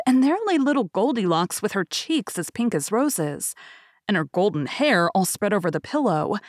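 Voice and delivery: usual speaking voice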